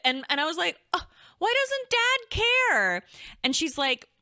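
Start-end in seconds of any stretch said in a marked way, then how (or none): 0.9-3.0 baffled whine. Retold or imitated. Confused at dad's shrug.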